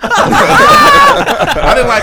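high, slow, laughing protest. Real, not acted.

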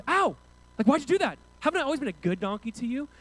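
high, loud wounded protest; indignant donkey. Acted. Beaten donkey complaining to its master, high indignant voice. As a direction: yelp it out as a hurt, offended donkey.

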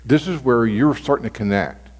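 ordinary talk, nothing marked

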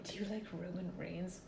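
hushed, voiceless joke question; mock whisper. Co-host jokingly asking about a wrestler, mock whisper. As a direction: whisper it as a playful aside.

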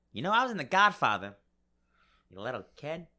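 high-pitched Italian-American wiseguy; Joe Pesci. Acted. Rejected actor pleading his credits, high Joe Pesci impression. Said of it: "This is Joe Pesci auditioning for Friday".

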